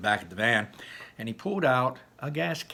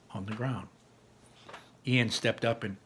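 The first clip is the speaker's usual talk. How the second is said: ordinary delivery, nothing marked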